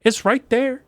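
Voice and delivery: fast, high, worked-up rant; rising heat, calling out the complainers. Real, not acted. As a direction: get fired up about the reviewers. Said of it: "I'm getting a little heated about it".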